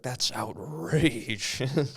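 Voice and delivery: mock outrage. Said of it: "everyone will be like".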